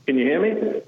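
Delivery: thin, distant speakerphone sound; talking into a speakerphone. Real, not acted. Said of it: "You sound like you're on a speakerphone".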